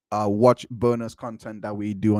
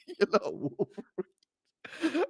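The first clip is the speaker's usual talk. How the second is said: high, drawn-out teasing through laughter; laughing mockery; 8 semitones higher, 7 dB quieter, much slower than usual. Real, not acted. Commentator ridicules the witness's story, laughing mockery. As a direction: laugh helplessly as you tease.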